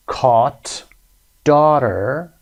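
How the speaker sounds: "caught", "daughter", each loud with open AW; AW as in saw. Shown as a demonstration. Teacher reads down the column for learners, open AW. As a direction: model each word separately, GH silent. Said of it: "صدای آ مثل saw".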